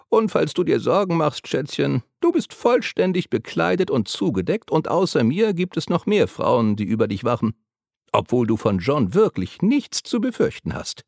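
put-on high reassuring old lady voice; cheeky old woman. A narrator's reading. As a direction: soothe the girl as the brisk old woman. Letty's voice held. (hohe Stimme der alten Frau, beruhigend)

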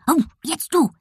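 high yappy little dog; excited dog. A narrator's reading. Dog hands cat her turn, yapping. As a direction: yap it out, pitched high and eager. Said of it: "hopste der kleine Hund".

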